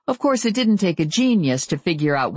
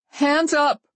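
high, nervous command; shaky voice; 12 semitones higher than usual. A narrator's reading. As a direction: bark it but shaking. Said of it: "said a shaky voice".

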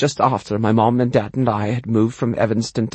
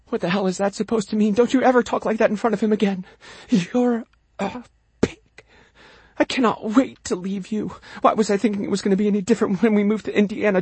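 wife fighting in the next room; quarrelling mother; 9 semitones higher, faster than usual. Acted. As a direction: berate your husband, pitched up. Mother battling father in background.

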